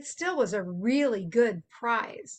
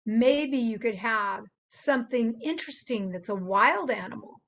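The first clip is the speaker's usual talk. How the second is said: normal talking voice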